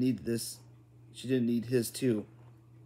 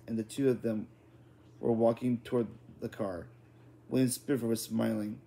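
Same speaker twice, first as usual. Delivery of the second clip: plain reading voice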